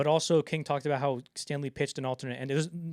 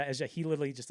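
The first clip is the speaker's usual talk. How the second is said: ordinary delivery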